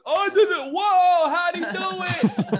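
high, loud imitation of a crowd going wild; mock amazed crowd. American Idol crowd reacting to a magic trick, amazed high shout. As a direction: whoop it up like a stunned audience.

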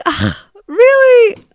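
a high, drawn-out groan of disbelief; really fed up, slow and sarcastic; high, slow exasperated "really". Retold or imitated. Annoyed mom retorts to rude clerk.

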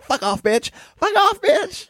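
very high shrieking mimic; escalating high-pitched mock shout; mock yelling. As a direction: shriek it back camp. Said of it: "He's like".

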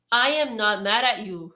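loud, stressed "I am not mad at you"; firm denial. Retold or imitated. To the pleading coworker, firm and laughing. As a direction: lean on "am" to shut it down. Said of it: "I'm like".

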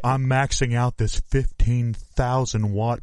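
ordinary delivery

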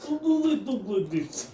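put-on high-pitched Russian-accented hamster. Player trying out Russian hamster talk, high Russian accent. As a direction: squeak it out in mock Russian. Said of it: "They're actually Russians".

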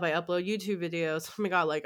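ordinary delivery

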